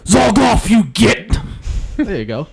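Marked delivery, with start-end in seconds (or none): 0.1-1.4 loud high orc snarl. Acted. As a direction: bark it like an angry orc. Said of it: "let me rip apart your creative talent".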